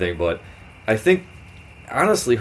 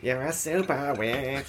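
a badly faked Australian accent, slow and much softer; terrible Aussie accent; 10 dB quieter, slower than usual. Host jokingly repeats the phrase in a botched Australian accent. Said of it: "That was a terrible Aussie, too".

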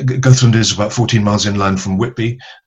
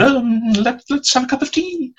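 much higher, small nervous voice; flustered stalling actress. Retold or imitated. Actress X stalling live on air, in a tiny high nervous voice. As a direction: squeak it out like a panicking guest. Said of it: "gul-, gulped a bit and said".

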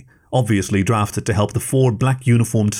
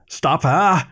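sharp high command; shouted order; 6 semitones higher than usual. Acted. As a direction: agent yelling to the others, pitched up. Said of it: "one of the remaining agents shouted".

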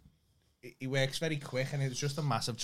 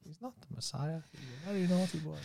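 a hint of Monty Python scolding; Monty Python impression; 3 semitones higher, 4 dB quieter than usual. Quoting the film to co-host, lightly voiced Monty Python impression. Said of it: "Monty Python, innit?".

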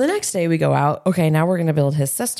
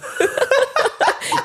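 high, airy laugh; burst of laughter. Real, not acted. Laughs at the threat about dad.

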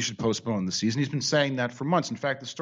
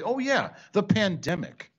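mock realization; 6 semitones higher, slower than usual. Coach feigning a sudden bright idea.

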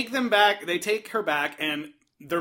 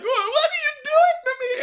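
high, fast hammed-up panicked plea; hammed-up frightened victim; mock crying; faster than usual. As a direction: squeal it out like a terrified victim. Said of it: "crying, like".

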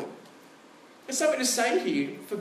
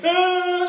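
put-on sudden high, loud kid's wail "Ah"; imitated child cry; 11 semitones higher, 8 dB louder than usual. Pastor imitating a child who cries straight after a small bang, high wail. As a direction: mimic a child crying out at once. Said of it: "you're like".

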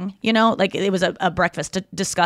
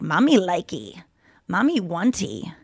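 put-on baby talk; 3 semitones lower, 6 dB quieter, slower than usual.

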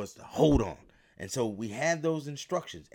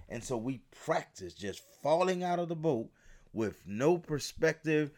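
ordinary delivery

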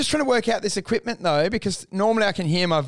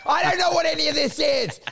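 exasperated outburst; 9 semitones higher than usual. Retold or imitated. Mock-baffled yell at the tech guy. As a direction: throw up your hands at the jargon.

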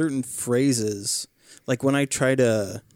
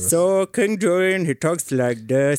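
slow, higher comic Indian-African accent; King Julian; 5 semitones higher, 4 dB louder, slower than usual. Shown as a demonstration. Showing the hosts his lemur accent, goofy Indian-African accent. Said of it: "so I do that accent".

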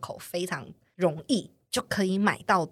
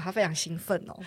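ordinary talk

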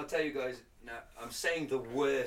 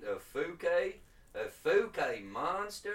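put-on slow, stretched American South accent; Southern US accent; 3 semitones higher, slower than usual. Host retrying after criticism, slow Southern US accent. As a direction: draw the vowels out in a mock Southern accent. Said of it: "trying to go for the southern".

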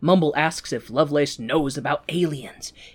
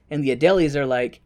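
ordinary delivery

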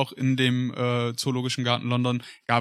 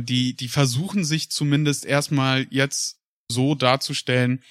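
ordinary talk, nothing marked

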